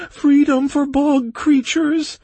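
very high, loud, drawn-out squeal; creature's plea. Acted. Bog creature clinging to a leg, pleading. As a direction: cry out slowly in a piping creature voice.

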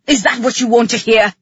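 loud, fast retort; exasperated man shouting back; angry shout. A narrator's reading. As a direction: fire it back hard and quickly. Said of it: "he shouted at me".

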